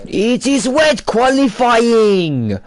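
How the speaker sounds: slow, high, loud Eastern European commentator; mock Eastern European race caller; Eastern European accent. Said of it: "Eastern European accent".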